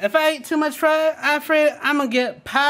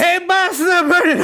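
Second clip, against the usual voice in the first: loud, raised call to the boss; excited shout; 5 semitones higher, 5 dB louder than usual. Acted. To the boss about the burning drapes, excited shout. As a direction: sound the alarm with glee.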